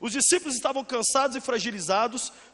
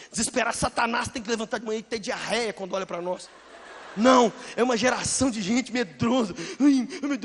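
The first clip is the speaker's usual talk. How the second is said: ordinary talk, nothing marked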